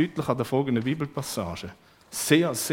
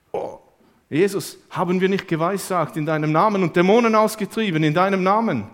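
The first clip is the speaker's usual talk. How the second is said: higher, louder eager boast; proud disciples; 6 semitones higher, 8 dB louder than usual. Acted. Disciples boasting to Jesus, eager and loud.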